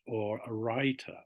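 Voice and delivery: usual speaking voice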